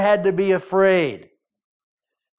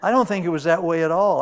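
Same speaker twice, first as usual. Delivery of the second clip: ordinary talk, nothing marked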